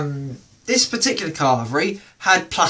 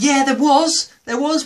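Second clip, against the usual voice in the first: Susie insisting; 8 semitones higher than usual. Retold or imitated. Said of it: "she said".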